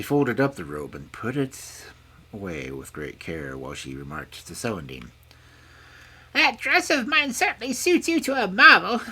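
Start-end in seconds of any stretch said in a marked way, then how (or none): 6.4-9.0 high, loud crone voice; remarking old woman. A narrator's reading. Old woman judging the princess's new dress, pointedly.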